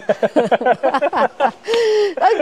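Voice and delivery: a long real burst of laughter, high and bright; sheepish real laugh. Real, not acted. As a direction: laugh it off, embarrassed. Laughs at own failure to graduate.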